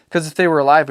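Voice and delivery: put-on a child reasoning; boy insisting. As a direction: son making his point. Boy's voice arguing.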